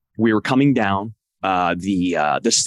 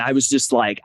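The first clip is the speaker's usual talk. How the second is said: usual speaking voice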